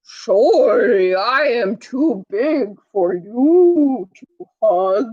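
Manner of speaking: a lightly voiced slow whale. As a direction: stretch the words out like a big whale. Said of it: "That's how whales talk".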